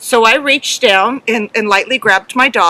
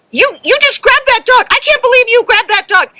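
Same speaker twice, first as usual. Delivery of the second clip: much higher, louder accusing judge; mock outraged judge; indignant judge; 14 semitones higher, 4 dB louder than usual. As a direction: squeal it out like a shocked official. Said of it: "the judge goes".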